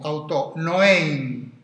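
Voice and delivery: auto noein drawn out slowly, each word weighed; slow stressed auto noein. Shown as a demonstration. Lecturer unpacking Parmenides' Greek for the class, slow and deliberate. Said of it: "es lo mismo".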